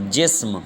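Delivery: جسم as one syllable "jism", no vowel before m; correct pronunciation. Shown as a demonstration. Teacher giving the right answer, careful "jism" for جسم. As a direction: say جسم as "jism", closing s straight into m. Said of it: "تو درست جواب ہوتا ہے".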